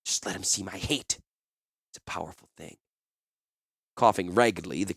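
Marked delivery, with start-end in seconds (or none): 0.0-2.8 hushed, dropping much softer at the end; whispered. Acted. Woman confides in her companion after the threat, whispering. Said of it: "Takara whispered".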